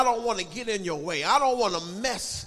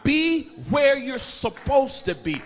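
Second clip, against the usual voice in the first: loud, raised, hammering exhortation; full-force preaching; 5 semitones higher, 11 dB louder than usual. Real, not acted. Preacher warns teenagers and grown adults, full-force preaching. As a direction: drive each command out at full voice.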